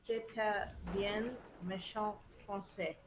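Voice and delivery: careful, slow attempt at French; attempted French pronunciation. Shown as a demonstration. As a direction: have a tentative go at proper French. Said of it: "my attempt at saying that properly".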